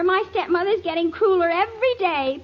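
normal talking voice

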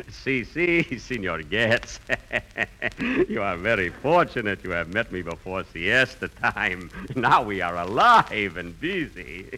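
put-on lazy, laughing Spanish-accent villager; Manuel, Spanish accent. Manuel welcoming Senor Getz before siesta, Spanish accent. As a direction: chuckle through it in a heavy Spanish accent. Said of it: "Today I am Manuel".

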